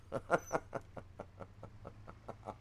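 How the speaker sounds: long fit of voiceless laughter; laughing. Real, not acted. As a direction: crack up at your own silly voice. Cracks up at own surfer voice.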